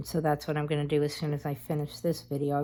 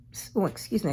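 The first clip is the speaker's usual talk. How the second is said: hiccups; 5 semitones higher, faster than usual. Real, not acted. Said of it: "I got the hiccups".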